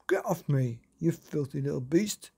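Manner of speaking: mock shout of a cross girl. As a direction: shove the creature away. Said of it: "shouted Myrtle".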